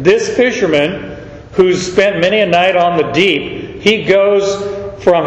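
usual speaking voice

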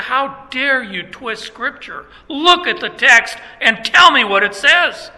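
teacher scolding the class for twisting Scripture; emphatic rebuke. Retold or imitated. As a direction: confront them, indignant. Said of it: "I got emphatic with them".

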